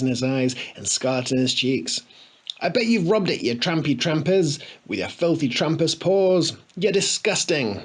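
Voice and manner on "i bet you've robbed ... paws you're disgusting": indignant rant. A narrator's reading. Harry ranting at tramps.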